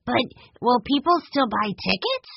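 put-on high-pitched questioning child; curious young boy. A narrator's reading. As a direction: ask it as a curious little boy. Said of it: "Benny wanted to know".

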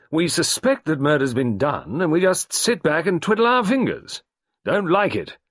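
high, loud, fast clipped colonel; Carbery demanding action, high loud colonel; gruff colonel. A narrator's reading. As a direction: bark it like an impatient old soldier. Carbery grumbling.

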